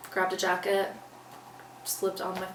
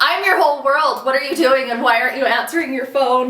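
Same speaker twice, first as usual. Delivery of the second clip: higher, louder furious demand; mad yelling; 6 semitones higher, 13 dB louder than usual. Retold or imitated. Daughter yelling at family who won't answer, furious. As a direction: yell at them for ignoring your calls. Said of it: "And I'm getting mad".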